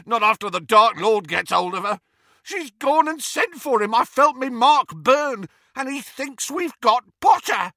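very high, loud, frantic; panicked bellow. A narrator's reading. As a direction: rant in terror and anger. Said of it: "bellowed Amycus".

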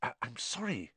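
hushed, stammered apology; whispered. A narrator's reading. Boy afraid of being heard downstairs, whispered. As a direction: apologise very softly, faltering. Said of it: "he whispered".

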